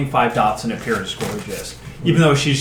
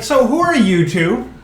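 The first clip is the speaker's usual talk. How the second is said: high-pitched NPC voice; 9 semitones higher than usual. Acted. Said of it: "And it's like".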